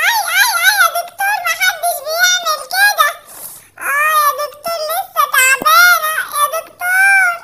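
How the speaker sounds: high, fast ouch-ouch whimper; girl character yelping at the doctor's shot; whiny little girl. Acted. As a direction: squeal at the doctor like a small girl. Girl doll gets injection.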